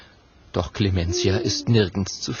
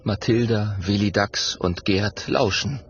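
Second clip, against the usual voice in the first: ordinary delivery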